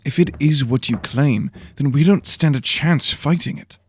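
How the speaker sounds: put-on young apprentice uneasy and doubtful; young Dalen, worried. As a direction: fret to your teacher. Voices Dalen in the story.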